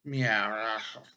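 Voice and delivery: loud, strange cat meow. Acted. Simkin answers the muttering tailor with a loud cat meow. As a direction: yowl like a cat at night. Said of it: "Simkin said".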